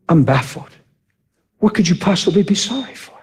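usual speaking voice

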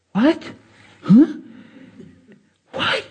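imitated stunned little questions, rising; mock bewildered gasping questions; freaking out. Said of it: "now she's freaking out".

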